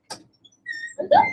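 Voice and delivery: squeaky voice for the kitten; high-pitched talking to a pet. Real, not acted.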